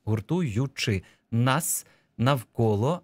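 syllables and words pulled apart; slow dictation. Shown as a demonstration. Teacher reading the dictation text back, slow syllabic delivery. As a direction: dictate very slowly, piece by piece. Dictation phrase read out slowly.

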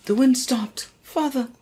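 put-on high, alarmed young princess. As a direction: cry out for father, high. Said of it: "I was doing all the other voices".

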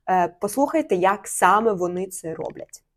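normal talking voice

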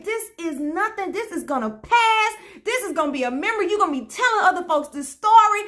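high, emphatic retold declaration; fervent encouragement. Stranger speaking into a frightened woman's life, fervent encouragement. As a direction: speak it over someone with rising conviction.

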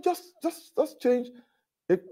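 a retold guest's high-pitched frustrated plea to the clerk; exasperated high plea. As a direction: beg them to just change it, voice climbing high. Retold exasperation at hotel desk.

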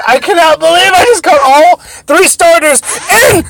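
high, loud, building to a wail; excitement tipping into a dismayed shout; excited disbelief. Real, not acted. Amazed at catch, then dismayed.

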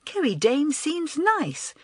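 picture meeting the star; eager thought. A narrator's reading. Girl thinks about the film star, mildly eager. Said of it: "she thought eagerly".

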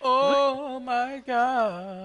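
long, high, slow oh-my-God; drawn-out exclamation. Real, not acted. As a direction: stretch it out slowly, pitched high. Drawn-out reaction to teammate.

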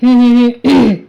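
pointed hmm hmm hmm to get attention; deliberate throat-clearing hums to interrupt a couple. A narrator's reading. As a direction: clear the throat meaningfully three times. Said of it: "घसा खाकरून त्यांना आवाज देत".

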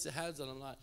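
ordinary delivery

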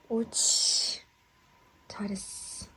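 whispered praise for a pet; talking to a dog. Real, not acted.

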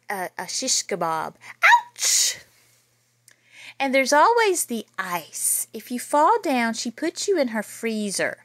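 lively read-aloud storytelling voice; story narration. A narrator's reading. Teacher reading a spooky school story to children, lively. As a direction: read it out big and lively for the kids. Reads picture book to kids.

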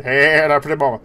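a light Johnny Bravo impression; lightly voiced cartoon flirt. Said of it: "Johnny Bravo was fun".

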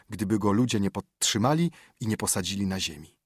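ordinary delivery, nothing marked

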